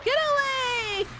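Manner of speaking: high, slow squeal; high shriek. Real, not acted. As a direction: shriek it out in panic. Game jump scare.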